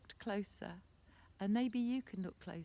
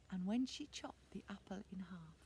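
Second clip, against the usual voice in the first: hushed, suspenseful whisper; 8 dB quieter than usual. A narrator's reading. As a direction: whisper toward the reveal. Suspenseful reveal.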